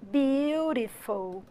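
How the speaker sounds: "Eiffel Tower is beautiful." drawn out very slowly; slow model sentence. Shown as a demonstration. Teacher gives an English example to students, very slow. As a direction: stretch every word for the students. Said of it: "Então eu falaria".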